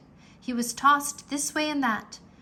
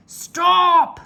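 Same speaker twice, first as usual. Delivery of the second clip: very loud yell; pirate yell; 8 semitones higher, 13 dB louder than usual. A narrator's reading. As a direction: yell after them. Captain yells at fleeing crew.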